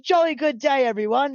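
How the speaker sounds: high-pitched, jolly British imitation; excited British accent. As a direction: greet all like an overexcited British manager. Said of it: "he was so excited".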